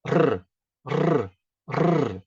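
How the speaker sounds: "Rr, rr, rr" as separate tongue-tip rolls; trilled R. Shown as a demonstration. Teacher drilling the vibration, repeated rolled rr. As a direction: let the air out and vibrate the tongue on each "rr". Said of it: "solta o ar e ele vibra".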